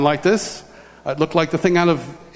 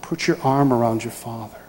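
voice of the Lord; 7 semitones lower, 3 dB quieter than usual. Retold or imitated. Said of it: "And the Lord says".

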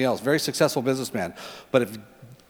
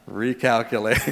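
low, softer navigation announcement; GPS lady; 6 semitones lower, 3 dB quieter than usual. Retold or imitated. GPS lady answering a driver who ignores her, low voice. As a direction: imitate the sat-nav lady recalculating. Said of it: "and then she says this".

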